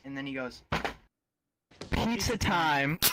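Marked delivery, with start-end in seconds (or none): 1.9-3.0 slow, loud, higher-pitched proclamation; loud announcement. Retold or imitated. Spider-Man arriving at the counter, loud announcement. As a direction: announce it big, stretching each word. Hero's triumphant announcement, acted loudly.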